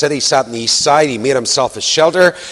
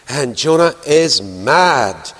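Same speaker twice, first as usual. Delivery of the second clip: ordinary talk, nothing marked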